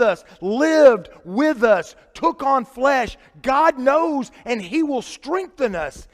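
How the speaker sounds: fervent pulpit proclamation; preaching build. Acted. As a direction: hammer each line home. Preaching climax on truth.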